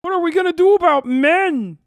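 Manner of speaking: mock his teary wail; mock bawling Peterson. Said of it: "he's just bawling at the".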